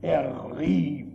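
"rief" hit hard and falling; contrast: not prayed but "rief"; stress on rief. Shown as a demonstration. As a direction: set "rief" against praying. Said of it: "Das heißt nicht, er betete".

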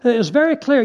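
usual speaking voice

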